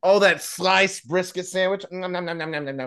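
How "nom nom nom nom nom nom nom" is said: softer, rapid nom-nom munching noises; pretend gobbling. Co-host relishing a brisket sandwich, playful gobbling noises. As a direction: imitate greedily chomping food.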